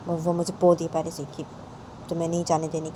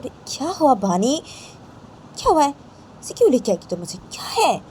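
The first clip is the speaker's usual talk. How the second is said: higher, louder surprised questions; surprised concern; 8 semitones higher, 5 dB louder than usual. Acted. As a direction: ask what happened, startled. Stepmother asking what's wrong.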